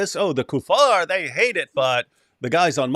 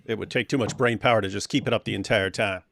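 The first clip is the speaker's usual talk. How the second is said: ordinary delivery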